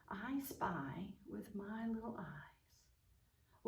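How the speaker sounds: soft, slow near-whisper; hushed I-spy game voice, drawn out; playful searching. Pretend. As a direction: search slowly under your breath.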